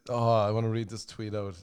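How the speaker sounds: ordinary talk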